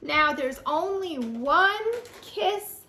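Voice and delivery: slow, drawn-out picture-book reading with big swoops; playful storytime narration, high exclamations; reading to children. A narrator's reading. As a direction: draw the little ones in, stretching key words.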